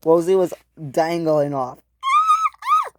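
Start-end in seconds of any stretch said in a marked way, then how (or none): 2.0-2.9 piercing squeals, very high; scream. Acted. Rosie dangling off, piercing shriek. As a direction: shriek at the very top.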